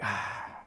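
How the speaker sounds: soft, airy sigh of dismay; exasperated sigh. Retold or imitated. Driver reacting when something happens on air, exasperated sigh. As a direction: let out a deflated breath.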